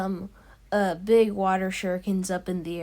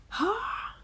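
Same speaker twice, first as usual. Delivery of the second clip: high, soft imitated battle cry; a held-back fighting shout, pitched way up; half-volume anime yell; mock battle yell; 12 semitones higher, 5 dB quieter than usual. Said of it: "and then he yells".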